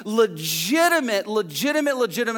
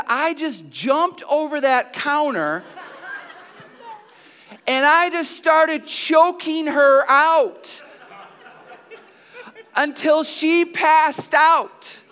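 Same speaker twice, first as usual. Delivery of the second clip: high, slow, building to loud; hammed-up retold rage, louder toward the end; mock outraged climax; 7 semitones higher, 4 dB louder, slower than usual. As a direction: stretch each word out in acted fury. Wife recounts attack, climax.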